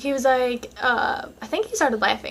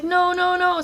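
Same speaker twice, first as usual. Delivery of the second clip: pleading no; 7 semitones higher, slower than usual. Retold or imitated. Begging boyfriend not to leave.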